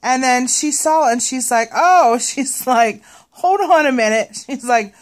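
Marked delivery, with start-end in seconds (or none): 1.7-2.2 high, loud startled oh; surprised cashier. Retold or imitated. Cashier sees the wrong price. 3.3-4.4 high, loud hold on; surprised cashier. Retold or imitated. Cashier sees the wrong price.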